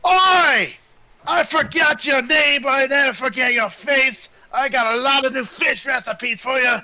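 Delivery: boisterous high holler; Jacques. Acted. Jacques greets a remembered big friend, loud and high. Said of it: "And then Jacques goes".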